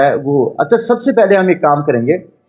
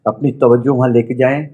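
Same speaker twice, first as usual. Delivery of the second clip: measured meditation voice with long pauses; guided meditation. Acted. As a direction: guide them inward, phrase by phrase. Leads a chakra meditation.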